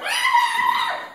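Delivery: high, loud piercing scream; kid's shriek. Retold or imitated. Little kid shrieking at his brother in the lobby, piercing scream. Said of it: "One little kid is going".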